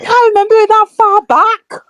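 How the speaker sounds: very high, laughing squeal; high-pitched protest. Real, not acted. Fan to host about a potato topping, high-pitched laughing protest.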